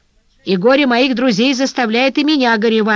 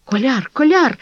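mild distressed cry, pitched up; 5 semitones higher than usual. A narrator's reading. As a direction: call the name in distress. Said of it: "вскричал Леон Ролан". (взволнованный вскрик)

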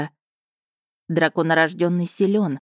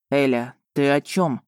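lightly voiced puzzled man, lower; a hint of a baffled man; 3 semitones lower than usual. Eiden, puzzled.

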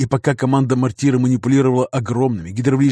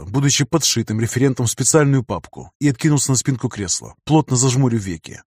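plain reading voice

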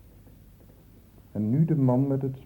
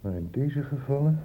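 ordinary talk, nothing marked

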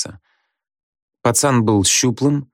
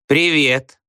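higher, squeaked pupil's hello; squeaky greeting; 6 semitones higher than usual. A narrator's reading. Back-row pupils greet the new teacher, squeaking. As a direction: pipe it up from the back desks. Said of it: "запищали с задних парт".

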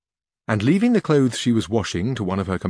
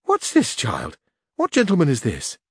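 exclaimed; 4 semitones higher than usual. A narrator's reading. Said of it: "she exclaimed".

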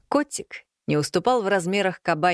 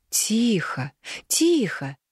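soothing hush; 5 semitones higher, much slower than usual. A narrator's reading. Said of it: "постаралась я его успокоить". (успокаивающий шёпот)

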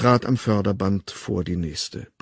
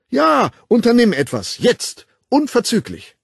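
trumpeting; 13 semitones higher, 7 dB louder than usual. A narrator's reading. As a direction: trumpet it out like a lecturer to young students. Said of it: "Fannys Stimme wurde zur Posaune".

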